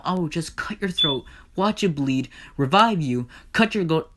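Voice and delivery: furious tirade. Acted. Hades rages at Persephone over incest, furious tirade. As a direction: tear into them with escalating threats.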